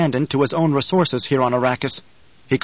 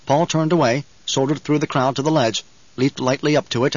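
ordinary delivery, nothing marked

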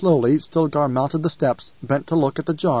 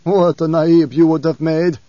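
rueful chuckle; 3 semitones higher, 6 dB louder than usual. Acted. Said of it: "A thin chuckle escaped Stilgar".

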